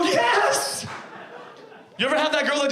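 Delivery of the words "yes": high, ecstatic yelp of yes; excited yes. Retold or imitated. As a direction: squeal with fry joy. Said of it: "I go".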